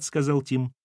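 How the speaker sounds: usual speaking voice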